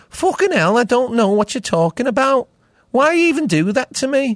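high-pitched, aggrieved Liverpool accent; chippy modern Scouse. Shown as a demonstration. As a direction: complain shrilly in present-day Scouse. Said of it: "now it's just a bit more chippy".

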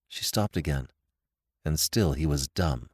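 ordinary delivery, nothing marked

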